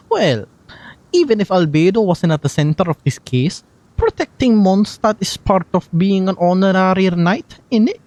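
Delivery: lightly Scottish Venti voice, playful; a gentle Scottish lilt as the bard; Scottish accent. Acted. Streamer voicing Venti's lines, in a light Scottish accent. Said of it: "Scottish Venti".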